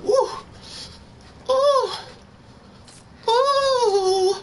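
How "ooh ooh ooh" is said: squealing high ooh; high excited whoops. Real, not acted.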